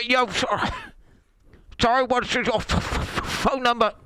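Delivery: high, loud, broken-up call voice; phone sound effects. Shown as a demonstration. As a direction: imitate a crackly, shit-quality phone line. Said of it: "I'm trying to do phone sound effects".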